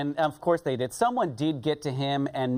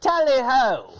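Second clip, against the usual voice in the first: loud, high posh British "Tally ho!"; mock British accent; 7 semitones higher, 6 dB louder, slower than usual. Asked for a British goodbye, a loud mock British tally ho. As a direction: shout it like a hammed-up English gent. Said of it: "say goodbye in your best British accent".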